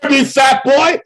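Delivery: high shouted robber voice; bank robber. Retold or imitated. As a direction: yell the stickup order up high. Quoting the robbery scene.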